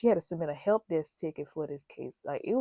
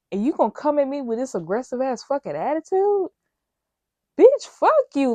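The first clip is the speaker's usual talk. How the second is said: shouting, much higher and louder; furious outburst; 7 semitones higher, 8 dB louder than usual. Real, not acted. Cursing out the aggressive supervisor, furious shout. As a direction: explode at her, full rage on the curse.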